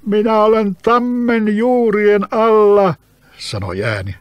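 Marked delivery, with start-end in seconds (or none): 0.1-3.0 high, loud, slow voice from below; eerie spirit calling up; trapped spirit voice. Acted. Spirit answers from under roots.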